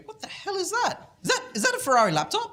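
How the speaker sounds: high, fast excited disbelief; amazed clients. Retold or imitated. Clients amazed at the Ferrari laptop, high and excited. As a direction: gasp it out like a wowed client. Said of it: "They go".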